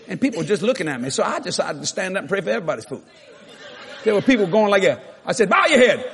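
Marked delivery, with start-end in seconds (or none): 5.5-6.1 raised, higher-pitched order to a crowd; bossy public call to prayer; retold loud command. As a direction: call it out like ordering a room to pray. Preacher ordering restaurant crowd to pray.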